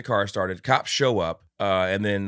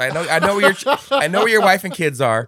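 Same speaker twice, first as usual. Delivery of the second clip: meek robber; 7 semitones higher, 6 dB louder than usual. Retold or imitated. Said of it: "unintimidating voice".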